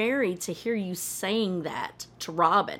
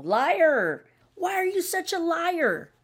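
sharp accusing outburst, voice jumping higher; 11 semitones higher, 4 dB louder, slower than usual. Real, not acted. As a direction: call him a liar to his face, loud and higher. Ranting at Kody on screen.

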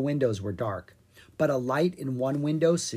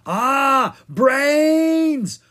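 much higher, loud scream of fright; terrified scream; 12 semitones higher, 9 dB louder than usual. A narrator's reading. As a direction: shriek in panic at the brain. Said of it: "Owen screamed".

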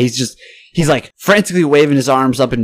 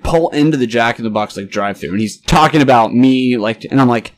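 ordinary delivery

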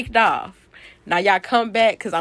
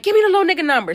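heated demand; 9 semitones higher, 6 dB louder, much faster than usual. Retold or imitated. Retold demand during the fight.

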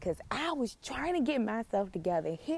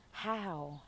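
usual speaking voice

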